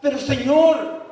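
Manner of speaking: loud, high, anguished cry of protest; pleading believer. Acted. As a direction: beg the Lord to be recognized. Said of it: "Ustedes conocen el texto".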